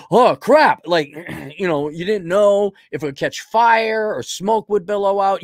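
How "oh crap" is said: mock panic gasp. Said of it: "be like".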